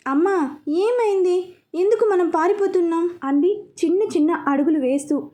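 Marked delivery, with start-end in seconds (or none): 0.1-3.1 put-on lightly raised, childlike questioning; a little girl asking her mother; small child's voice. A narrator's reading. (కొంచెం పసిపిల్ల గొంతు)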